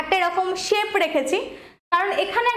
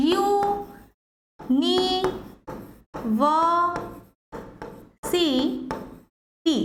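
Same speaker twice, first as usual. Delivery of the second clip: very drawn out, broken into syllables; much slower than usual. Shown as a demonstration. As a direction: pull the word apart syllable by syllable. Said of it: "দেখো".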